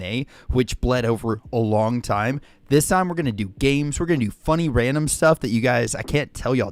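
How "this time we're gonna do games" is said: usual speaking voice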